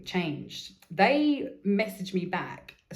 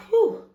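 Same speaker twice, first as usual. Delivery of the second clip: high loud whoosh of relief; relieved whew; 10 dB louder than usual. Retold or imitated. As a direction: blow out a big relieved whew. Relief after long silence.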